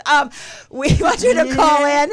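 big, animated and laughing plea; playful Italian flourish. Pretend. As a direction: ham up the call-in plea. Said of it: "It's an Italian thing".

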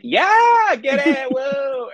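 very high, loud party whoop; hyped cheer. Shown as a demonstration. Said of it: "then I'll be like".

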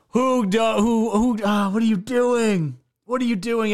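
slow, incredulous "what are you doing"; drawn out, like you can't believe it; exasperated disbelief. Real, not acted. Exasperated at the woman.

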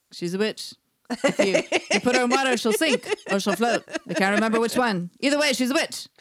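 loud, fast, blustering dog voice; a yappy little Shih Tzu ranting; cartoon dog. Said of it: "he'll be like".

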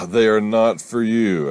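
high, loud, drawn-out telling-off; scolding. Acted. Mother guarding cupcakes from her son, scolding. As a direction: forbid it loudly, stretching the words.

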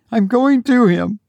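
high, pleading and urgent; desperate. Acted. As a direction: push past him, frantic. Mother desperate to reach son.

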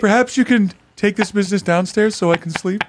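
high, prim and polite voice; overly polite. Pretend. Timid guest asking the dealer Maurice politely. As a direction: ask ever so politely. Prissy neighbor addressing the dealer.

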